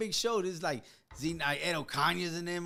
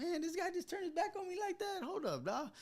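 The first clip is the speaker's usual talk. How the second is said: high, hushed indignant thought; retold indignant disbelief; 10 semitones higher, 9 dB quieter than usual. Teller reacting to the DJ turning his back, retold indignant disbelief. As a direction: squeak it quietly to yourself, affronted.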